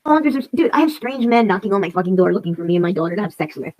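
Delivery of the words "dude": usual speaking voice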